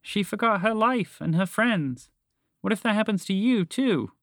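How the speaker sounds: high, fretful mouse voice; worried. Acted. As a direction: plead with a friend out of concern. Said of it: "added with worry".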